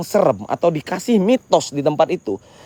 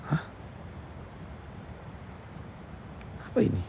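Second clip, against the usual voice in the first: much softer, hushed questions; 5 semitones lower, 20 dB quieter, faster than usual. Real, not acted. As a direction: whisper it so nothing hears you. Nervous near unknown object.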